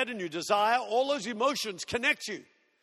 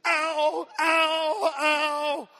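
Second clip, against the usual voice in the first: higher, pained little yelps while running; hammed-up cries of pain; mock yelps of pain; 6 semitones higher, 4 dB louder, much slower than usual. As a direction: imitate a girl yelping ow with each step. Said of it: "So she goes".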